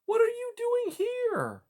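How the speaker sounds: high, slow startled cry; young girl's high surprised cry. Acted. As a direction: call out high in disbelief. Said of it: "she cried".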